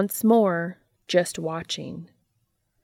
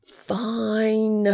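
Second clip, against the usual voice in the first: drawn-out, exasperated concession; dramatic sigh; 3 semitones higher, 4 dB louder than usual. A narrator's reading. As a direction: give in with a hammed-up, sighing groan. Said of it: "The empty sighs dramatically".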